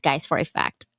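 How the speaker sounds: ordinary talk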